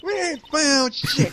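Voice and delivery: high, slow mock holler; high-pitched joking outburst; comic quote shout. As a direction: draw each word out in a hammed-up cry.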